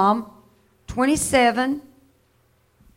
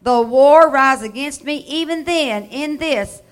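preaching at full force; 2 semitones higher, 5 dB louder than usual. Acted. Preacher booming the psalm to his congregation. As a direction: proclaim it to the whole room.

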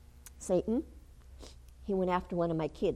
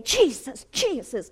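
raised, forceful cry of a holy name; urgent invocation, easing on the repeat; calling out; 5 semitones higher, 6 dB louder, slower than usual. Retold or imitated. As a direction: call the name with all authority. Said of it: "calling out the name of Jesus".

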